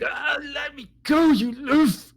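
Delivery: very high strained shout; captive's angry shout. Pretend. Dangling drow yells at the giant, high strained shout. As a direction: yell to be put down. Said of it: "you just hear".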